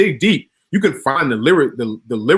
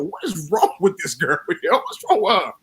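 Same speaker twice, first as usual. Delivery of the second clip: laughing, incredulous retold question; mock bewilderment breaking into a laugh; amused disbelief; 4 semitones higher than usual. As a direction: ask it baffled, cracking up.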